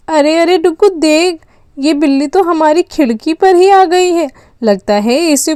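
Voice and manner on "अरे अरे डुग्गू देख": ordinary talk, nothing marked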